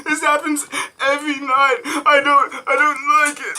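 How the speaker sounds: high, loud hammed-up sobbing; mock crying. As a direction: wail like a wronged child.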